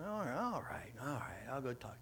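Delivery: soft, grudging compliance; reluctant giving in. Retold or imitated. Answering the Holy Spirit's nudge, reluctant giving in. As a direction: give in under your voice, half-hearted.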